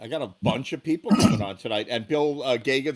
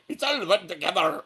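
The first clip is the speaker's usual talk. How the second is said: put-on slurred, run-together Irish accent; mock Irish accent; 4 semitones higher than usual. Host mimicking the Irish singers on the song, mock Irish accent. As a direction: imitate a thick, hard-to-follow Irish accent. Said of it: "listen to the Irish people".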